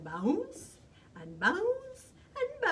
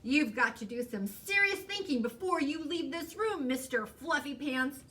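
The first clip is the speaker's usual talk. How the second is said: raised, firm motherly lecture; stern talking-to, pitch lifted; lecturing mother; 5 semitones higher, 5 dB louder than usual. A narrator's reading. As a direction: lecture a naughty child. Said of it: "The lecture went on and on".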